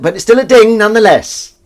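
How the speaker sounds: high, loud proclamation; mock triumph. Host consoling a viewer with a ding, loud mock triumph. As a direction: announce it with a big swell at the end.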